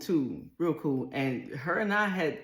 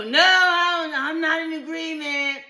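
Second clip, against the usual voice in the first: husband objecting; 12 semitones higher, 5 dB louder than usual. Retold or imitated. Husband objects in retold scene.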